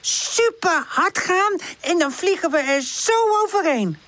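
put-on high, upbeat young character; high-pitched cheerful enthusiasm. A narrator's reading. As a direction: bubble with carefree confidence. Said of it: "zegt Charlie opgewekt".

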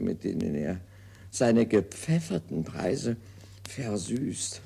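put-on fussy old invalid grumbling over his bills; Molière's imaginary invalid, complaining to himself; hypochondriac Argan. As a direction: play the petty, self-pitying patient. Acts Argan's monologue.

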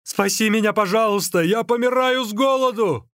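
high, urgent begging; pleading. A narrator's reading. Starving runner begs the magician to save him, pleading. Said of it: "взмолился Ликуй".

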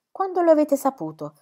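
high, loud, sharp question; indignant demand. A narrator's reading. Sir James, overcome with anger, questions Brooke, indignant demand. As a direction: demand an answer, angry and urgent. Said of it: "ancora al culmine dell'indignazione".

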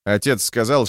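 plain reading voice

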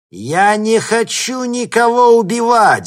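high, loud, drawn-out furious cry; enraged, slow and forceful; angry. A narrator's reading. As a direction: shout it with real menace. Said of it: "зло сказала она".

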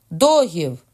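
bellowed order, pitch falling at the end; shouting. A narrator's reading. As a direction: shout it out as a command. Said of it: "крикнув Карл".

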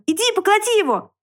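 high squealed command; high-pitched angry demand; piglet shouting. A narrator's reading. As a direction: order it in a high, worked-up squeal. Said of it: "закричал поросёнок".

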